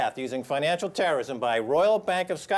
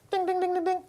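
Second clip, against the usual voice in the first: high sung ding-ding of a ringing phone; phone ring imitation; 11 semitones higher, faster than usual.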